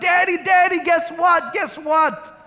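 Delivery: put-on excited child yelling. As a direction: call out like a thrilled little boy. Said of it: "bounding through the house yelling out".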